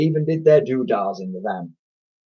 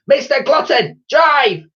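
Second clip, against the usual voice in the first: high, loud, nasty shout; harsh command shouted in a nasty voice; nasty yell; 7 dB louder, slower than usual. A narrator's reading. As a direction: yell a getaway order at the driver. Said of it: "This was in her nasty voice".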